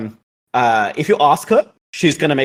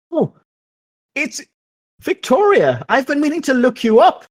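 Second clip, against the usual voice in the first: higher-pitched, halting social greeting; Marie Joanne greeting; 8 semitones higher than usual. Acted. Marie Joanne greets Victoria and friends, high social greeting. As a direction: play a high-voiced acquaintance hailing someone. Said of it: "Marijuan comes out and says".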